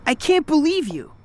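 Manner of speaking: indignant outburst. Acted. Daughter confronts mother over affair.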